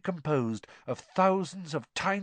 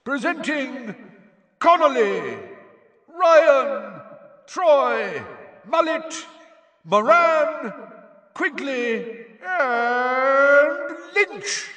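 high, loud names, each ringing out; Bagman's yelled roll call; 13 semitones higher, 6 dB louder, much slower than usual. A narrator's reading. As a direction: announce each player, building to the last. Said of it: "yelled Bagman".

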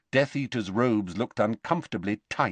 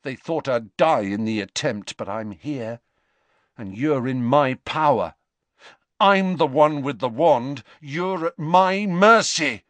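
building louder and higher, the end slowed; defiant Malfoy; 5 semitones higher, 5 dB louder than usual. A narrator's reading. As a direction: grab the upper hand, rising to a boast. Malfoy asserting his power.

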